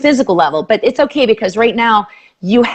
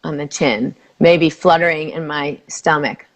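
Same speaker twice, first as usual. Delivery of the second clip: soothing tapping script; guided tapping; 5 semitones lower than usual. Acted. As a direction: lead the phrase gently for her to repeat.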